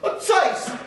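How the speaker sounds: ordinary talk, nothing marked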